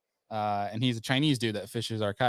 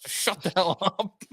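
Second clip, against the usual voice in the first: mock angry retort; 7 semitones higher, 5 dB louder than usual. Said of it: "And I'm like".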